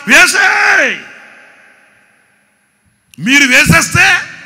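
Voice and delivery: a king roaring the sentence; furious royal order; angry command shout. Acted. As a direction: bark వేసేయ్ loud and high. King orders them thrown in.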